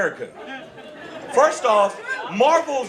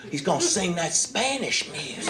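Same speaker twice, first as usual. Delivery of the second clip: quiet, lower mumbling bigot voice; mock bigot; 3 semitones lower, 4 dB quieter than usual. Comic mocking a bigot complaining about [name], quiet and low.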